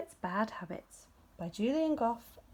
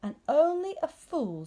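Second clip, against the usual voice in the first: severe; 8 semitones higher, 7 dB louder than usual. Acted. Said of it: "said Rabbit severely".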